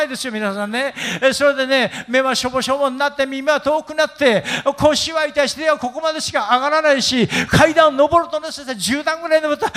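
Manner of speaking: put-on high-pitched old person's lament; elderly complainer. Old person complains to pastor of failing body, high lament.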